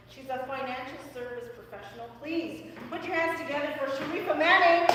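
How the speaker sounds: loud, high announcer build to the name; guest introduction. Acted. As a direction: bring the guest on like a game-show host.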